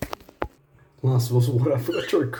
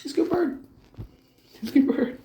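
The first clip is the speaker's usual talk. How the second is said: much higher, sweet praising voice; faster than usual. Real, not acted. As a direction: praise the bird in a high coo. Praising the budgie.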